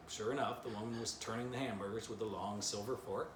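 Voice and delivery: usual speaking voice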